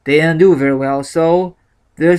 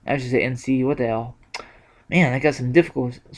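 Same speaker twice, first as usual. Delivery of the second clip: usual speaking voice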